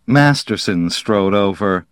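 ordinary delivery, nothing marked

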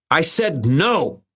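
high, drawn-out mock roar; narrated roar. A narrator's reading. Irwin refusing the bullies, a contained roar. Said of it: "Bigfoot Irwin roared".

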